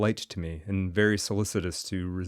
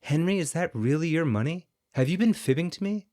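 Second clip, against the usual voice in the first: put-on higher, wide-eyed young woman's voice; startled surprise, half accusing; astonished; 9 semitones higher than usual. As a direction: ask it in amazed disbelief. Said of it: "Portia's turn was come to be surprised".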